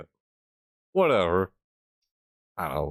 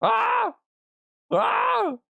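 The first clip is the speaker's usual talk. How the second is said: demo scream. Shown as a demonstration. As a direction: scream as if the mic clips. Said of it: "when I scream something".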